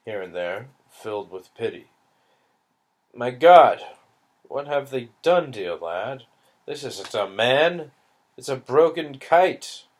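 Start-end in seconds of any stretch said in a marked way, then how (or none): 3.1-9.9 pitying Englishman. Acted. Englishman pities the broken boy.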